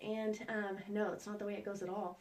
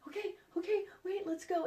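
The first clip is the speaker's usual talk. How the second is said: high, hurried "okay, okay"; flustered mom; 10 semitones higher than usual. Retold or imitated. Mom hurrying her son off the trail, high and flustered. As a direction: rush him along in a panic. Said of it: "I'm like".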